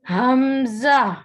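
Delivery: "ہمزہ" slow, from the chest; letter drawn out. Shown as a demonstration. Teacher drawing out "ہمزہ" from the chest. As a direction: stretch "ہمزہ" slowly. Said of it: "یہ بھی سینے سے ادا ہوتا ہے".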